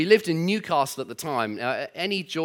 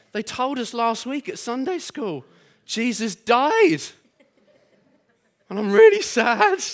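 put-on sad Geordie child; 7 semitones higher, 4 dB louder than usual. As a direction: play a small Geordie girl, heartbroken. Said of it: "butcher the Geordie accent".

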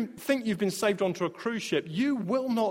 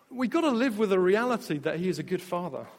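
normal talking voice

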